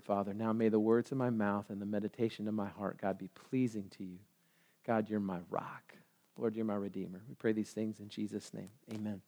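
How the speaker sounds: low, soft congregational prayer; quiet prayer. Real, not acted. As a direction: speak humbly to God, voice sinking toward the amen.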